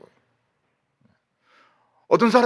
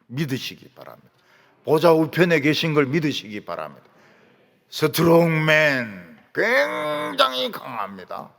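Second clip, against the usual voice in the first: ordinary talk, nothing marked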